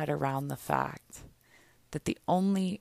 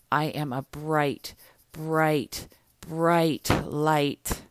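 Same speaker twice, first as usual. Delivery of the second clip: affirmation. Shown as a demonstration. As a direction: declare each line as a self-affirmation. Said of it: "I want you to breathe into this right now".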